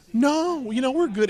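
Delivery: high, breezy no-thanks; mock-casual refusal of an autograph, high; polite brush-off. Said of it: "polite refusal retold".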